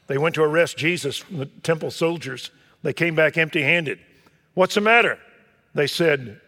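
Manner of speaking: loud, high, fervent preaching; preaching at full force. Acted. Preacher pressing his flock with full force. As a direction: thunder it out from the pulpit.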